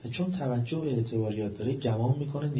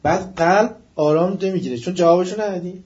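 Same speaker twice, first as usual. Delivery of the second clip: loud, raised preaching that keeps building; fiery preaching; 3 semitones higher, 8 dB louder than usual. Acted. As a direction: hammer the point home with rising force. Sermon rising to loud climax.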